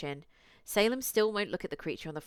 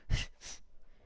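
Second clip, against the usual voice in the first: soft laugh; 4 dB quieter than usual. Real, not acted. Laugh after joke to chat.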